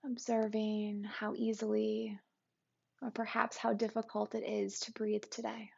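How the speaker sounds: calm, even meditation guide with long pauses; guided meditation. Acted. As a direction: guide listeners gently into the body, unhurried and settled.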